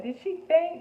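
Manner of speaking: high, loud mock alarm from office staff; alarmed question. Office staff rushing out ask in loud alarm. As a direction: ask it in sudden worried shock. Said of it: "they were like".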